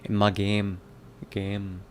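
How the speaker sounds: practising a Jamaican accent word by word. Shown as a demonstration. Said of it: "Jamaican accent".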